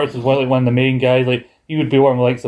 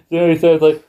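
ordinary talk

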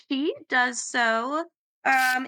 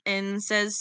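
ordinary talk, nothing marked

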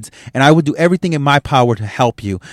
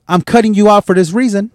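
higher, mocking sing-song "Hey, I'm cutting you out"; mock announcement; 7 semitones higher than usual. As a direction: parody a fake polite goodbye, pitched up. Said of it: "I'm not gonna sit here and say".

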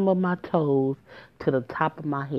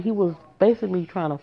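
normal talking voice

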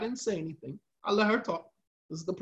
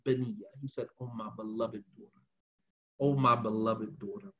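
soft, low and tender, much quieter; gentle father; 8 semitones lower, 9 dB quieter than usual. Retold or imitated. The Prophet tenderly addresses his daughter, soft and low. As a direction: coax a beloved daughter gently. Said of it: "in a very gentle way".

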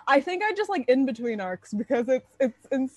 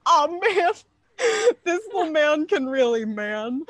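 mock tearful awe; 7 semitones higher, 4 dB louder than usual. Retells tearful reaction, laughing.

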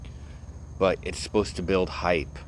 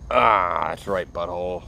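ordinary talk

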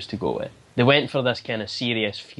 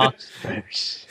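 butler voice; 3 dB quieter, slower than usual. Retold or imitated. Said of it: "mock Alfred callback".

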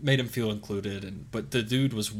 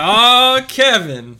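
much louder and higher, thrilled hello; excited greeting; 13 semitones higher, 16 dB louder, much slower than usual. Retold or imitated. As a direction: call out his name as if overjoyed to see him. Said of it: "And I'll be like".

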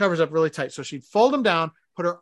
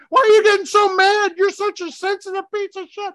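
much higher, loud shrill mock wife; imitating the wife, angry; 14 semitones higher, 8 dB louder than usual. Husband imitates his wife yelling back about the covers, very high and loud. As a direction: play the furious wife, hammed up. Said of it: "And she's like".

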